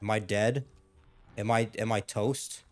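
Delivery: ordinary talk, nothing marked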